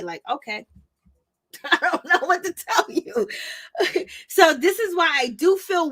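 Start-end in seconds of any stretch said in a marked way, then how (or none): 1.6-4.1 higher, laughing shrug; words riding on a laugh; amused laughing. Real, not acted. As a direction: laugh it off while talking. Laughing off the critical commenters.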